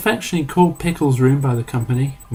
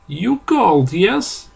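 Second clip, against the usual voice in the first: put-on high, loud Eastern European accent; hammed-up Eastern European voice; thick Eastern European accent; 8 semitones higher, 4 dB louder, slower than usual. A narrator's reading. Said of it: "in a thick Eastern European accent".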